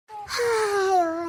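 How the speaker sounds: long slow crying ಅಯ್ಯೋ; lament. Acted. Hungry mother-in-law laments alone at night with a slow cry. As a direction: wail ಅಯ್ಯೋ slowly in hunger.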